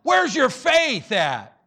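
loud, high, drawn-out demand; exasperated challenge; stern rebuke. Acted. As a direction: throw it out slowly at full force. Repeats Jesus's rebuke at full force.

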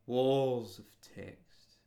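Walls of text drawn out slowly; slow heavy stress on Walls. Real, not acted. Slow emphatic repeat.

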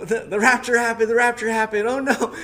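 loud, high mock screams of alarm; retold panicked screaming. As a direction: act out screaming down the street. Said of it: "I'm screaming".

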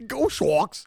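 lightly mocking Boston fan; mock Boston accent. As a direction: echo the Boston bro voice. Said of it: "content creator that's from Boston".

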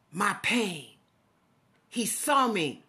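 normal talking voice

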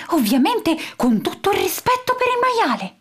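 high whispered aside; croaking whisper. A narrator's reading. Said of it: "gracchiando piano". (sussurro gracchiante e acuto)

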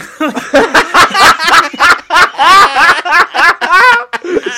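big loud laugh; loud laughter; long loud laugh. Real, not acted. Long laugh after punchline.

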